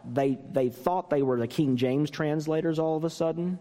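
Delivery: normal talking voice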